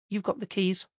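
flat, unimpressed deadpan. A narrator's reading. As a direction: say it flatly, no interest. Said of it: "Terry said flatly".

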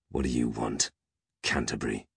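low, menacing growl. A narrator's reading. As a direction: growl it irritably at the butler. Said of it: "growled Peg".

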